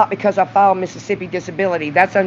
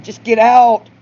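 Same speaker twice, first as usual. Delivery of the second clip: loud, higher get out; retold shout; 4 semitones higher, 6 dB louder, slower than usual. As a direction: shout him out of the house. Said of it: "I said".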